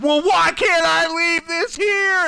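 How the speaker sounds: high, slow shouted complaint; imitating a shrieking customer; mock shouting customer. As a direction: yell it like an irate customer. Customer quoted screaming.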